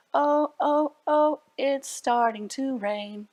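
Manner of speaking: slow, sing-song rhyme read to little ones; poem for children. Acted. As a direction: recite it like a nursery rhyme, slowly.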